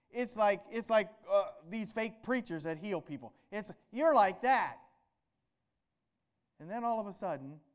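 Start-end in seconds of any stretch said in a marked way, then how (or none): 3.9-4.8 sneering jab. Acted. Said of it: "These scribes would be taunting".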